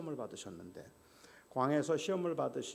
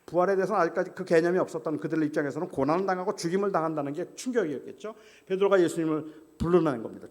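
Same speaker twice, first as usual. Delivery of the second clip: ordinary talk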